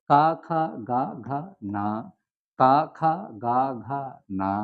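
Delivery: কা, খা, গা, ঘা, না with an open a vowel added; Pali consonant drill. Shown as a demonstration. Teacher repeating Pali sounds for learners, open a vowel on each. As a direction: say each letter as কা খা গা ঘা না. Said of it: "সবসময় না উচ্চারিত হবে পালিতে".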